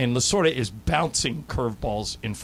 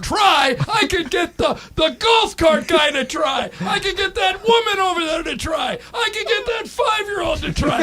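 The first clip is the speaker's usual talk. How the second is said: a much higher, louder mock tirade; imitating Lasorda's shouting rant; 4 dB louder than usual. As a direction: keep hammering the word try in a shout. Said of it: "Lasorda goes".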